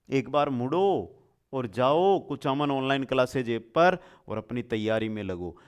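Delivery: ordinary talk, nothing marked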